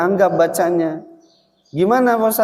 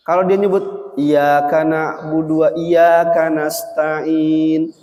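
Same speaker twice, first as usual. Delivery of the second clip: ordinary delivery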